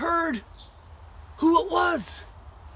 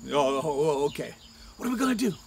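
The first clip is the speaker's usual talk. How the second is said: deep men's voice; 12 semitones lower, faster than usual. Acted. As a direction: drop low for the other men. Men respond, deep voice.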